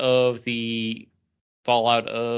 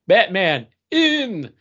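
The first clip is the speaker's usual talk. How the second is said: mock-dramatic announce; 13 semitones higher, 7 dB louder, much slower than usual. Teasing Chris about his lost pick.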